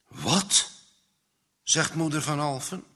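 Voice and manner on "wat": startled mother's question, rising sharply; taken aback, questioning; astonished. A narrator's reading. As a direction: react in disbelief. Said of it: "haar zoon verbaasd aankijkend".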